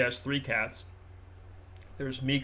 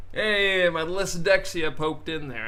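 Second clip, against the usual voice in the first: normal talking voice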